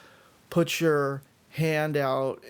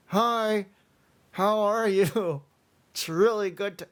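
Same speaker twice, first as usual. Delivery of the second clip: forced smile, words through clenched teeth; through gritted teeth; 5 semitones higher than usual. Shown as a demonstration. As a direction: greet someone with jaw clamped shut. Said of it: "you don't have to talk through your teeth".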